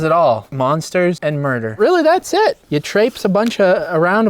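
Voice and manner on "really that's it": high squeaky refrain. Acted. Catchphrase refrain, high pitched.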